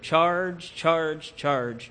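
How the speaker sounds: rally the men, lightly voiced battle cry; roared charge. A narrator's reading. Colonel rallying his men downhill, an understated roar. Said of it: "the school teacher from Maine roared".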